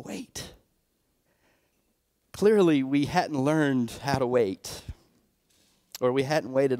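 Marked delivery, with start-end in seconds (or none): 0.0-0.5 barely voiced, breathy hush; hushed whisper. The Lord answering the couple, a hushed whisper. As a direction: whisper it like a quiet divine answer.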